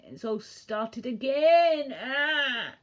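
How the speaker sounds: loud, high, drawn-out mock cry; mock despairing wail. Streamer laments politics repeating to viewers, loud mock wail. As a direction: wail it out in exaggerated exasperation.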